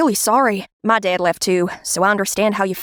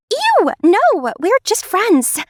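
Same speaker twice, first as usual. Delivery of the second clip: higher, slow embarrassed "ew"; grossed-out denial; 8 semitones higher, much slower than usual. Acted. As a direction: brush off the teasing, squirming. Teased about boyfriend.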